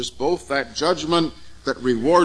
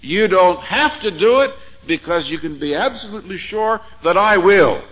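raised, ringing preacher voice; preaching; 7 semitones higher, 7 dB louder than usual. Real, not acted. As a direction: preach it out with full force. Preaching at full force.

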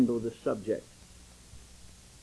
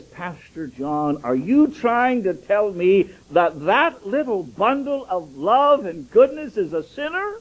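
indignant mother; 3 semitones higher, 6 dB louder, slower than usual. Retold or imitated. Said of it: "she had fire in her eyes".

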